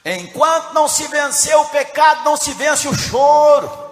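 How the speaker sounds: loud proclamation, slowing at the end; forceful preacher's declaration; full-force preaching. Real, not acted. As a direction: proclaim it to the whole church.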